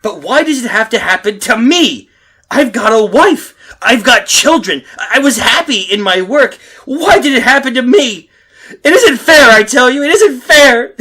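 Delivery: high, crying outburst; breaking down. Acted. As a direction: voice cracking into sobs. His breakdown over lost family.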